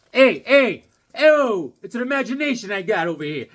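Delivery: high, loud shouts, then quick patter; mock calling out. Voicing modern-day Conan in the neighborhood, playful calling out. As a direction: holler out for the drawn character.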